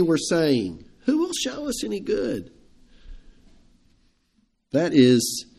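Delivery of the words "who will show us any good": defeated sigh. Retold or imitated. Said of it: "a defeated sigh".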